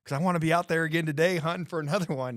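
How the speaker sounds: high, loud, fast and eager; eager to go again. Retold or imitated. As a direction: rush it out like a keen hunter. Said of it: "And I was like".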